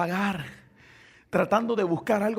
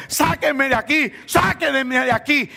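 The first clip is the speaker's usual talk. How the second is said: high cry for rescue; raised, pleading preacher's re-enactment; acted shouting; 6 semitones higher, 3 dB louder, slower than usual. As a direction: shout up from the pit as the trapped youth. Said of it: "ese joven gritando".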